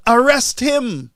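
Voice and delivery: mock shout. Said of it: "shouting out".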